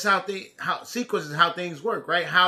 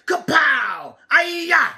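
ninja battle shout; 3 semitones higher, much slower than usual. Acted. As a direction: shout kung-fu cries and tease the chasers.

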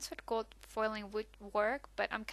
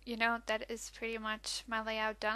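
ordinary delivery

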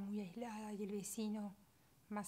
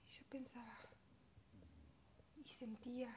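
soft and broken, fading away; drained grief; 3 semitones higher, 13 dB quieter than usual. Acted. Mother left alone after the burial, soft drained grief. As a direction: let it sink to a near whisper.

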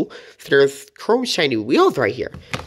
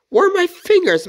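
flustered surprise; 5 dB louder than usual. Real, not acted. Fumbling with toy, startled.

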